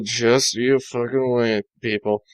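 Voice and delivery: a hint of menace; ominous aside. Real, not acted. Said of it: "aside to listeners".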